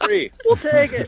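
very high-pitched comic Scot; hammed-up squeaky Scottish voice; mock Scottish accent. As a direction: pipe up as a delighted thrifty Scot. Punchline of the Scottish joke.

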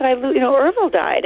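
high, mildly tearful retelling; retold crying. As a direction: lightly imitate crying over bad news. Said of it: "I'm crying and I said".

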